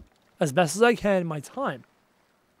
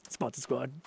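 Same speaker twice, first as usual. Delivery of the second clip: much lower and softer; muttered catchphrase; 8 semitones lower, 16 dB quieter than usual. Real, not acted. Streamer murmurs the catchphrase after welcoming a sponsor. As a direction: murmur it to himself.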